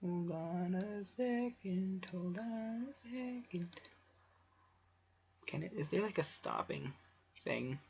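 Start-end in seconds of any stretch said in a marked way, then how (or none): 0.0-3.7 slow, higher, stretched out; playful slow sing-song stall; drawn-out stalling. Real, not acted. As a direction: drag each word out slowly.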